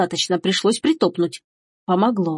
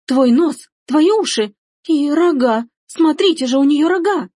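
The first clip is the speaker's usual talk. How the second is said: high, louder alarmed exclamations; horrified outcry; 7 semitones higher, 4 dB louder than usual. Acted. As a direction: cry out aghast, pitched up. Horrified sister points at horns. (в ужасе восклицает)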